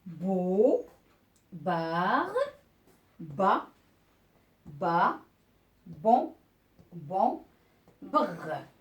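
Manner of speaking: each syllable alone, with a pause after it; teacher sounding out syllables one by one; reading out syllables. Shown as a demonstration. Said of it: "je vais lire les syllabes". (lecture syllabe par syllabe, bien détachées)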